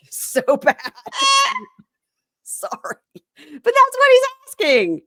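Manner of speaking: breathless laughter, voice leaping high; real laughing apology. Real, not acted. Podcaster defending her crude retelling, cracking up. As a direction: squeak the excuse out through laughter.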